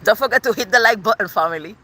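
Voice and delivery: normal talking voice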